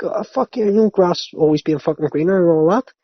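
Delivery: sarcastic fake agreement; mock sarcastic reply. Mock reply to regretful tweeter.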